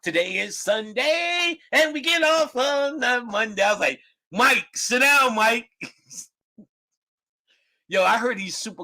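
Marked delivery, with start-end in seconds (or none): 0.0-3.6 goofy song voice. Retold or imitated. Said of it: "saying one of his goofy ass songs". 4.3-5.7 slow mocking put-down; dismissive heckle. Heckling Michael.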